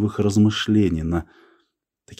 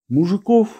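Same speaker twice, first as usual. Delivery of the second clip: "Мужиков" echoed as a high rising question; 10 semitones higher than usual. A narrator's reading. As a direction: ask "Мужиков" back, pitched well up. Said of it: "переспросил Иван Гаврилович". («Мужиков» — высоко, переспрашивая)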